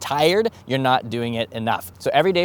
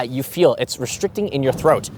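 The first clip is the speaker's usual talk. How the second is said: normal talking voice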